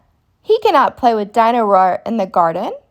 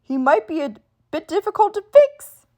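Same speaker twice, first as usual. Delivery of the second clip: unsure, hesitant dad; a father unsure he can mend the toy; doubtfully; 9 semitones higher than usual. A narrator's reading. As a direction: say it like you doubt it will work. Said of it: "says Daddy Pig doubtfully".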